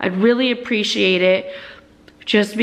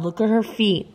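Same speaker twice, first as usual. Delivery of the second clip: normal talking voice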